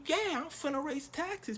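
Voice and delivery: high put-on politician voice; mock Ruto. Comedian voicing Ruto, high mocking voice.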